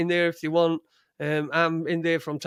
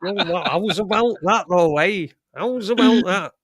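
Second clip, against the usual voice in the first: loud mock Savile Yorkshire drawl; hammed-up Savile catchphrases in his accent; Jimmy Savile impression; 2 semitones higher, 5 dB louder than usual. Podcast co-host mimics Savile for his laughing friend, Savile accent. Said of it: "you can do that accent as well".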